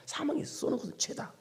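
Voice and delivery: whispered repeat. Shown as a demonstration. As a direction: breathe 사망이 쏘는 것은 죄다 as a hushed echo. Repeating the verse twice.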